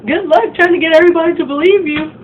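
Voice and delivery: high, loud smug taunt. Retold or imitated. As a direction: toss it over your shoulder. Mean girl parting taunt.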